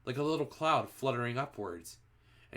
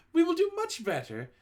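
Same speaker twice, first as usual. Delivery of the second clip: much higher, timid witches' voice; nervous witch audience; 5 dB louder than usual. A narrator's reading. Witches promising their leader, much higher nervous voice. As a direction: stammer a frightened promise. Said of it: "murmured the audience".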